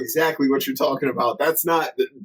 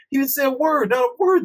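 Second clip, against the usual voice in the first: high, emphatic incredulous quote; mock disbelief; 10 semitones higher than usual. Retelling Dante learning the boss left silently, incredulous. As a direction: pitch it higher as the disbelief builds.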